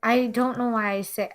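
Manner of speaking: normal talking voice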